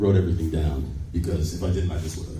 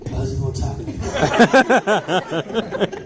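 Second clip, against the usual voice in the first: slow, higher mock drawl; laughing slow put-on voice; Norman Reedus impression; 8 semitones higher, much slower than usual. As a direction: stretch it out slowly, laughing. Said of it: "Norman's like".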